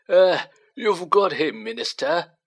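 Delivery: put-on a toothless old barman's welcome; old landlord Tom. A narrator's reading. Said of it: "said Tom".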